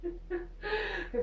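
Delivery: high, drawn-out giggly voice; laughing. Real, not acted. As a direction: squeal it slowly through laughter. Laughing over the character's crush.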